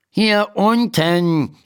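annoyed. A narrator's reading. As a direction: say it mildly irritated. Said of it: "sagt die Stimme verärgert". (leicht verärgert, langsam)

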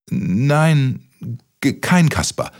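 high, halting stutter; frightened stammer. A narrator's reading. Kaspar denying himself to the giant, stammering. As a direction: stumble on the first sound, timid and high. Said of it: "stotterte Kaspar Mütze".

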